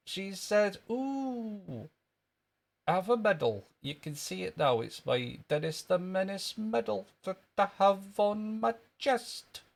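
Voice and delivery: high Scottish schoolboy voice; squeaky comic Scottish character; Jimmy Krankie. Acted. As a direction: show off as a little Scottish kid. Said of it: "That was wee Jimmy Cranky".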